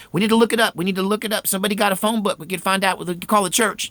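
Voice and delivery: high, urgent fretting; scrambling for a way out; anxious planning. Retold or imitated. Mother searching for the number, high anxious urgency. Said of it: "she's like".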